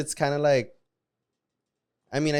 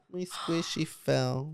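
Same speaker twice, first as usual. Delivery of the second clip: ordinary delivery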